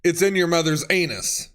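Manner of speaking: ordinary talk, nothing marked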